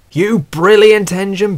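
high, delighted praise; beaming. A narrator's reading. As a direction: gush with pride, beaming. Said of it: "beamed the driver".

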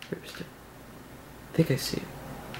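unvoiced whisper, sneaking. Real, not acted. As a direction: whisper so the mob won't hear. Sneaking, spotting a mob.

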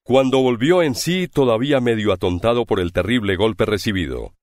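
ordinary delivery

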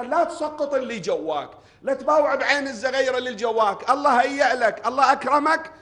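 rising from firm to high, loud pleading; building sermon climax. Acted. (تصاعد خطابي من الحزم إلى نداء عالٍ)

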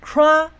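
"crack" high, loud and level; primary stress high. Shown as a demonstration. Teacher demonstrating stress levels, "crack" loud and high. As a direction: hit "crack" high and hold it. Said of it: "次重音再高上去".

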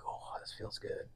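breathy whisper of delight; hushed, savoring whisper. Retold or imitated. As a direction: whisper it like a private pleasure. Recalling pleasure of blending graphite.

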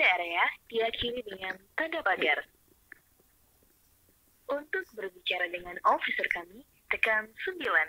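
polite automated phone menu voice; recorded hotline greeting, evenly paced; customer service voice. Acted. Same phone menu prompt replayed.

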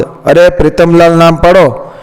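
ordinary delivery

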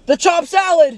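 high, yelped cry of alarm; a sudden shocked yelp as the drink spills over; surprised shriek. Real, not acted.

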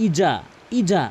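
ইজা with a hard j; wrong ija. Shown as a demonstration. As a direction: put a j sound in ইজা. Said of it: "এখানে অধিকাংশ লোক কী বলে জানেন?".

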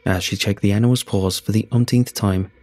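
ordinary delivery

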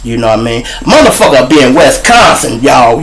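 ordinary delivery

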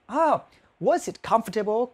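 normal talking voice

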